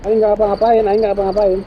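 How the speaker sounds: high, loud plea of innocence; panicked protest. Real, not acted. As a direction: protest frantically while being chased. Protesting innocence while chased. (protes panik dengan suara tinggi)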